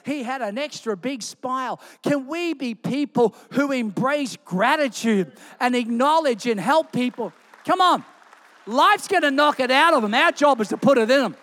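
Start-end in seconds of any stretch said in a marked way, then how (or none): 2.0-11.4 louder, rising preacher's exhortation; preaching at full force. Acted. Pastor urging the church to lift people up, louder preaching. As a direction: drive it home to the congregation. Pastor rallies congregation to gratitude.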